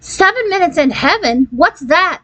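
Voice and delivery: high, eager, barely contained; excited squeal. A narrator's reading. Angela hearing about the party game, excited. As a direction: pitch it up in thrilled shock. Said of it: "Angela Kemp practically screamed".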